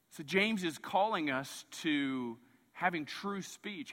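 normal talking voice

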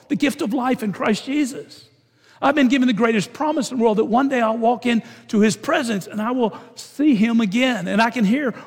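preaching with rising fervor; fervent preaching. Acted. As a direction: rise into the sermon's call, earnest and strong. Preaching builds up to appeal.

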